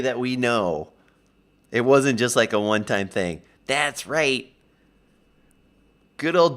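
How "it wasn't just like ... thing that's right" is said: normal talking voice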